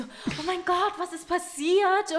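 high, slow, concerned gasp; worried friends. Retold or imitated. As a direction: sound alarmed for her. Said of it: "die so". (hoch und besorgt, gedehnt)

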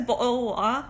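mock-British "bottle of water"; British accent. Co-host trying the classic phrase, British accent. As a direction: play up the British sounds in the phrase. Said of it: "who doesn't do a British accent".

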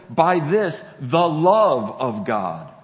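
"the love of God" with a loud, drawn-out "the love"; slow down and lean hard on "the" before "love"; stress on THE. Shown as a demonstration. Preacher showing the definite article to listeners, stress on "the". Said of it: "John includes a definite article".

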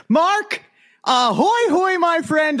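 high, bright burst of energy; energetic greeting. Acted. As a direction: call out the greeting full of pep. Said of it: "Listen to you with some energy behind your voice".

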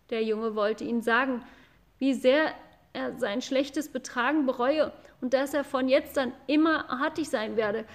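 ordinary delivery, nothing marked

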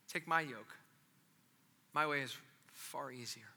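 low, very soft and tender; gentle invitation. Acted. Jesus to the struggling, gently inviting. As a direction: invite softly, without any scolding.

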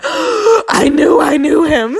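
high, slow, awed I knew I knew him; excited realization. Retold or imitated. Remembering the moment she recognized him, awestruck. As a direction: let it dawn on you, wide-eyed. Sudden recognition of twin flame.

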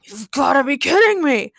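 can't believe this is happening; exasperated disbelief. A narrator's reading. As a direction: protest in gruff disbelief. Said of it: "Logan muttered".

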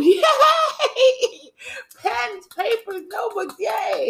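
very high, slow, excited squeal; squealing child excitement. Retold or imitated. Child's excitement about school shopping.

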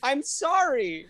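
high, slow, overcome voice; overwhelmed apology. Real, not acted. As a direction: plead like you're about to melt. Emotional over beloved Paddington.

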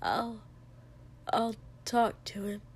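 soft, hesitant, slightly hoarse; a little hoarse. A narrator's reading. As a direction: falter quietly with a touch of hoarseness. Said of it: "voice a little hoarse".

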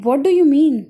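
an owl surprised by the birds' kindness. Acted. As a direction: ask it caught off guard. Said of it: "Auli was now surprised".

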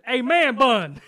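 loud high yell across the store; retold shout. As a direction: call him out loud and high. Yelling at a stranger.

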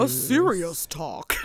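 slow, low, mock-serious voice. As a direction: put on a grave serious voice. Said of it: "Why is that the voice I'm doing".

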